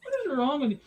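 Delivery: ordinary talk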